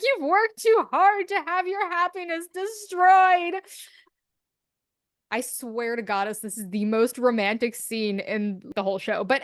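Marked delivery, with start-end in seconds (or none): 0.0-3.6 much higher, louder and slowed down, pleading; a big, desperate declaration; impassioned plea. Retold or imitated. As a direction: Emma pleading with Regina not to lose her happiness. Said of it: "And Emma's like".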